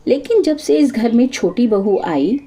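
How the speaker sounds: ordinary delivery, nothing marked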